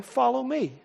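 gentle call. Acted. Jesus's call quoted.

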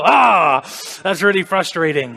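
loud exasperated groan then a complaint; mock frustrated shout; frustrated outburst. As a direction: blurt out the frustration. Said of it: "when you think".

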